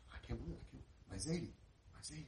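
soft, stunned disbelief, repeating himself; freaking out. Retold or imitated. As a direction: imitate a boy overwhelmed, almost under his breath. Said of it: "he's talking like this".

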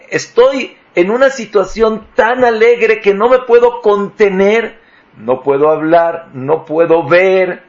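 high, loud, bursting with joy; overwhelmed with joy. Pretend. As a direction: speak as someone too happy to hold it in. Voicing overjoyed person.

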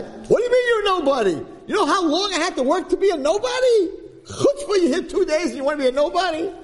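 high, whiny, almost crying complaint; outraged veteran. Retold or imitated. Veteran student whining at the new kid about his hard-earned nobody status. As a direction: wail in hurt outrage. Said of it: "This other guy".

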